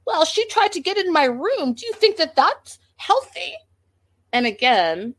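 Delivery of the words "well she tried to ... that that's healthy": high, loud mock whine; mocking Pedro. Imitating Pedro defending himself to Winter, high mock whine. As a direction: play him high and indignant. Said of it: "He's like".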